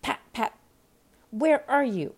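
high, angry calling; angry rabbit. A narrator's reading. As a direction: call out crossly as the rabbit. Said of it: "Next came an angry voice".

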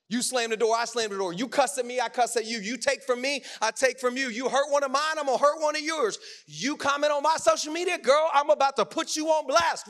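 hammed-up escalating argument; acted tit-for-tat quarrel. Acting out couples' tit-for-tat quarrel.

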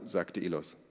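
ordinary delivery, nothing marked

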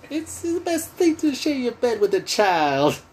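high falsetto celebrity spoof. Retold or imitated. Goofing on a pop star from the album, high mock voice. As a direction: goof on him in a high, put-on voice. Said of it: "he does this [name] thing".

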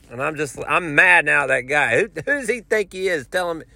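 ordinary talk

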